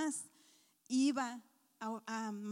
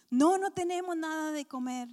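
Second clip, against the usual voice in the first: calling back; 4 semitones higher, 8 dB louder than usual. Acted. John answering loudly from boat.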